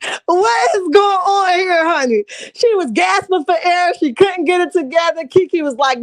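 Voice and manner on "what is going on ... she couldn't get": shouting out while crying with laughter; laughing shout. Real, not acted. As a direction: holler it through tears of laughter.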